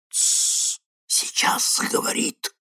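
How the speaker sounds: hushed unvoiced whisper; shush, then whisper in expectation. A narrator's reading. Stranger listening to his device hushes the host, whispering. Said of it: "прошептал незнакомец".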